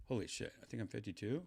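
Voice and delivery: normal talking voice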